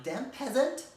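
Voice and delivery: high, loud scorn at a beggar; hammed-up snobbish outburst; haughty mock outrage. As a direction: cry out like an affronted aristocrat. Acts haughty reply to begging orphan.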